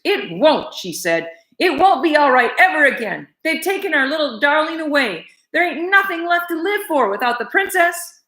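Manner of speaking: high, tearful lament; nothing left to live for; despairing cook. Acted.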